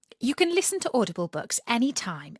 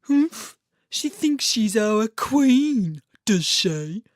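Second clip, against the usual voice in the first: lightly voiced grumbling carp, louder; grumpy carp; 5 dB louder, slower than usual. Carp Colin lightly scoffing at the swan. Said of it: "Only Colin the carp said".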